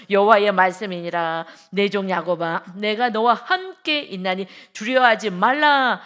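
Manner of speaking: slow, loud preaching proclamation; full-force scripture reading. Acted. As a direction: boom out God's promise slowly. Reads Jeremiah passage aloud.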